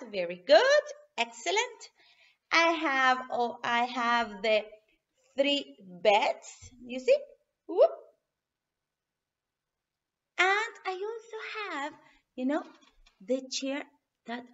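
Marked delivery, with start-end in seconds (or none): none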